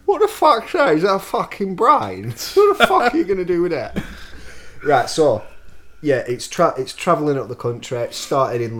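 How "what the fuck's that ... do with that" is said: mock Tom Hardy Cockney. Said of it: "Tom Hardy".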